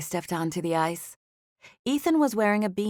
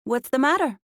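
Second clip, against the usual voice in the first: high, louder mock Mexican accent; thick Mexican accent; 7 semitones higher, 4 dB louder than usual. A narrator's reading. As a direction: ham up a bad Mexican accent. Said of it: "terrible but unashamedly thick Mexican accent".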